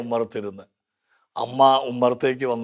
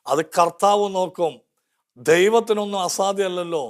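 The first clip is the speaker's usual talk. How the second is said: higher, fervent preacher voice; impassioned preaching; 6 semitones higher than usual. Acted. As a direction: rouse the congregation with rising fervour. Preaching climax. (ഉയർന്ന സ്വരത്തിൽ ആവേശത്തോടെ പ്രസംഗം)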